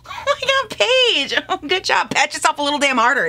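high squealing laugh. Real, not acted. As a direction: giggle through self-praise. Praising herself for shade match.

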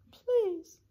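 high little plea; small, high-pitched pleading. A narrator's reading. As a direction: beg in a tiny mayor's voice. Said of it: "pleaded the mayor".

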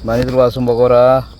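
loud call to come and eat; calling cats to eat. Real, not acted.

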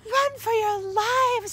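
high, loud, drawn-out cry; panicked urging. A narrator's reading. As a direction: shout it high to make them flee. Mother urges frogs to flee.

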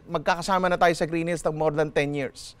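ordinary talk

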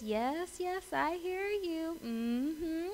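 soft, high, slow sing-song; serenely patient mom. Acted. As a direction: placate a crying child sweetly, softly. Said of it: "a smile on her face, the peace, and she's just like".

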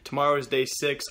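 ordinary talk

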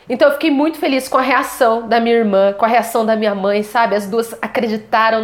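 ordinary talk, nothing marked

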